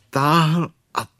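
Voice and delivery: slow, stretched words of effort; drawn out. A narrator's reading. Narrator drawing out the long pull on Pooh, slowly.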